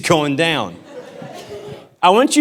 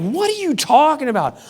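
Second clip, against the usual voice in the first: high-pitched mock bewilderment; retold protest; 10 semitones higher than usual. Retells his own protest to wife.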